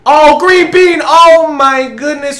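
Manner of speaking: loud, high cheering; excited shout. Real, not acted. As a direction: celebrate the make. Cheering a made shot.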